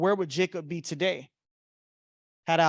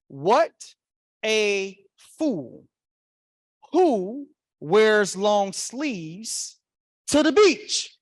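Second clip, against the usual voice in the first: slow, incredulous inner voice getting louder; mock scorn; 2 semitones higher, 5 dB louder, much slower than usual. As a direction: stretch each word, then burst out. Acts out his scornful thought.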